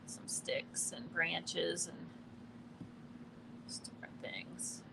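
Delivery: soft whisper under breath; whispering to self. Real, not acted. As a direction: mutter it in a whisper, just for yourself. Muttering to self while painting.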